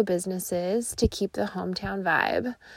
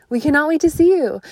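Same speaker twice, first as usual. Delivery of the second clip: excited; 6 semitones higher, 8 dB louder, much faster than usual. Real, not acted. Ad read excited close.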